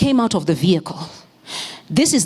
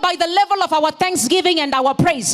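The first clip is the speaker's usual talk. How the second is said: preaching at full force; 9 semitones higher than usual. Real, not acted. Pastor rousing the congregation, full-force preaching. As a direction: shout it out like a fired-up preacher.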